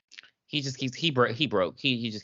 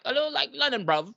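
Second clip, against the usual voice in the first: light, higher London accent impression; a hint of London bruv; mock London accent; 11 semitones higher than usual. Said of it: "he just sounds like".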